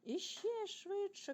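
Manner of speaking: high, long-drawn thrilled call; excited cry. A narrator's reading. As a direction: stretch the cry out high, topping the first one. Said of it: "кричав Тімі".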